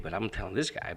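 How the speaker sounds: ordinary delivery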